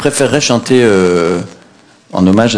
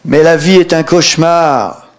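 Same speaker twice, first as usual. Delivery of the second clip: cri aigu et indigné; protestation du poète; 8 semitones higher than usual. Retold or imitated. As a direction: proteste haut et fort, outré. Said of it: "je l'entendis protester". (protestation exaltée)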